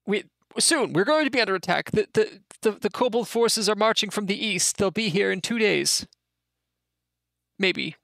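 high, halting goblin voice; nervous goblin messenger. Acted. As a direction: stammer the news up high like a scared goblin. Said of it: "spits out a tooth, says".

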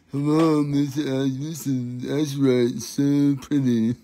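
lightly put-on, halting seller voice; mimicked crystal seller. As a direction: coo over a crystal, mock-sweetly.

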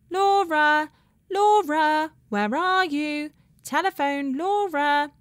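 high, drawn-out call from far away; distant cry. A narrator's reading. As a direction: call from inside the house, softened by distance. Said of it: "a voice cried from the house".

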